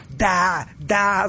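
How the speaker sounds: loud, high, exasperated shout; furious yell. Acted. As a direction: explode at the slow servant. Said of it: "заорал Неменхотеп в ярости". (яростно заорав)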